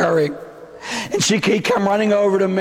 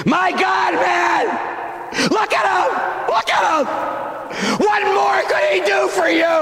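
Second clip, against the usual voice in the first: anguished shouting; 9 semitones higher, slower than usual. Acted. Mary crying out in anguish.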